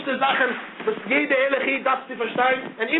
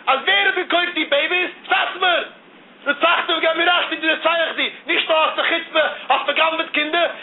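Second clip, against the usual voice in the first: raised, higher-pitched outrage; indignant outcry; 4 semitones higher than usual. Retold or imitated. Speaker retelling a scandal of sold babies, indignant outcry. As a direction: cry it out in disbelief at the charge.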